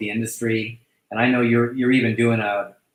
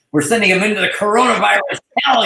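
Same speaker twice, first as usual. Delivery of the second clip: panicked quote; 9 semitones higher, 5 dB louder, faster than usual. Retold or imitated. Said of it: "I was like".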